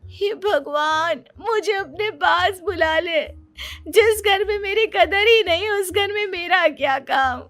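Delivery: high-pitched, drawn-out tearful lament; hammed-up sobbing self-pity; wailing daughter-in-law. Wife lamenting to God after husband's scolding, tearful wail.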